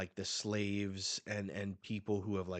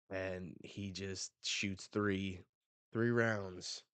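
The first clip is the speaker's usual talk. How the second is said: ordinary delivery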